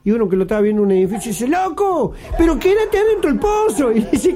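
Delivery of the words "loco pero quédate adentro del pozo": high-pitched onlooker calling out; mock yell from a bystander, pitched high. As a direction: call down to the man in the hole, high and urgent. Onlooker shouts down from building.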